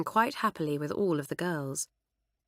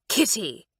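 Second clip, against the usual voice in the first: sharp; 2 semitones higher than usual. Acted. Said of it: "would say sharply".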